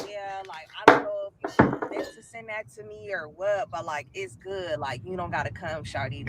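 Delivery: low, quiet and dismissive like the guy; mock boy voice. The boy telling her she doesn't have to come, low smug mimic.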